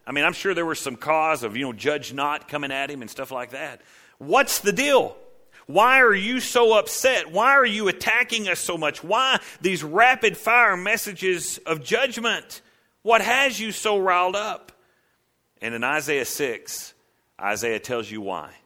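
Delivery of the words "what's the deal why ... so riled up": raised, louder, pressing questions at the prophet; indignant demand. Pretend. Congregation demanding answers from the angry prophet, indignant. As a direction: confront Isaiah on the congregation's behalf. Preacher voicing congregation at Isaiah.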